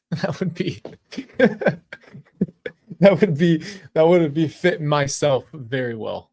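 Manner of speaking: giggling hard, words pushed out between laughs; cracking up, barely getting the line out; laughing through words. Real, not acted. Laughing while quoting her motto.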